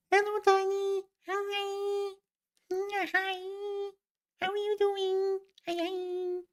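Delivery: very high falsetto greeting; cutesy squeak. Pretend. Streamer greeting Tiny, cutesy falsetto squeak. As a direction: squeal hello in a tiny, put-on voice.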